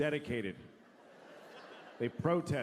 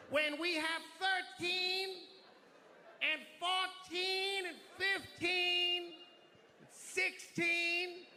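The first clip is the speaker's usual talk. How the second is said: high-pitched, strained rallying cry; slow, stretched-out numbers in a high protest voice; fervent protester; 13 semitones higher than usual. Acted.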